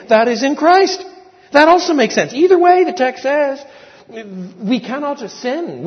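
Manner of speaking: booming sermon delivery; full-force preaching. Acted. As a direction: preach it out to the congregation.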